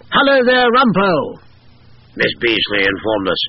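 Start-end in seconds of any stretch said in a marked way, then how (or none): 0.1-1.4 high, loud mannish medium voice; a lady medium booming out the colonel; woman channelling a hearty old soldier; spirit colonel through a medium. A narrator's reading. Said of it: "emerging in Miss Beasley's already somewhat masculine voice".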